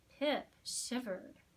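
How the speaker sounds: ordinary delivery, nothing marked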